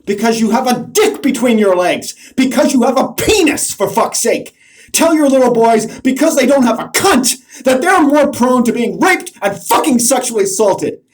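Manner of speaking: loud, high, furious yelling; furious shouting. Real, not acted. Ranting at the men listening, a furious shout. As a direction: hurl every word at them, enraged. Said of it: "And that's anger".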